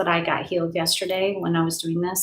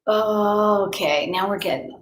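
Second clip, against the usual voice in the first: ordinary delivery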